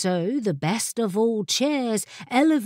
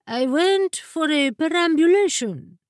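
high, put-on carpet voice; talking carpet; 7 semitones higher, 4 dB louder than usual. A narrator's reading. Carpet explains its absence to the familiar in a high character voice. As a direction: pitch it up as a self-important little creature. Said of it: "said the carpet".

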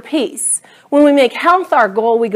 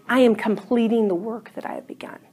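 whispered inner word; 9 dB quieter, faster than usual. Retold or imitated. The word God spoke inside.

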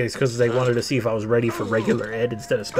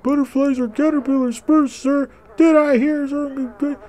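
drill instructor; 12 semitones higher than usual. Pretend. Gamer playing drill instructor to maggots, high mock bark. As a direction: bark orders in a raised put-on voice. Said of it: "I'm a drill instructor".

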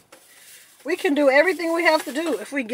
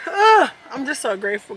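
emotional gratitude; 8 dB louder than usual. Real, not acted. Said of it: "start crying".

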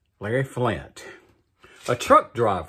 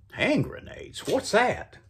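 high-pitched, mildly baffled echo; puzzled rise in pitch; puzzled question; 10 semitones higher, 3 dB quieter than usual. Acted. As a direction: ask it like you've never heard of them. Puzzled father echoes his son's words.

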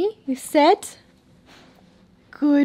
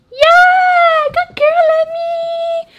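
squeaky praising a dog; 12 dB louder, much slower than usual. Real, not acted. Cheering dog for shaking.

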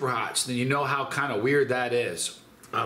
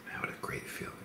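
blissful whisper; 13 dB quieter, faster than usual. Real, not acted. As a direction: breathe it out softly. Mellow after endorphin rush.

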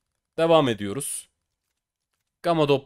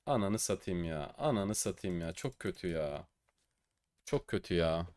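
much softer and lower groaning; deflated mutter; 6 semitones lower, 13 dB quieter than usual. Real, not acted. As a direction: sag with disappointment. Bad drop, deflated groan. (hayal kırıklığıyla mırıldanma)

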